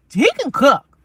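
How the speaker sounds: high, loud praise; excited praise. Real, not acted. Praising the bar's cook, high loud rave. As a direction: rave about the chef.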